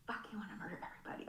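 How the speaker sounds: fast, hushed whisper through the anger; fierce whisper. Real, not acted. To the group about Texas drivers, a fast fierce whisper. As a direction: mutter it under your breath, fast. Driving frustration aside.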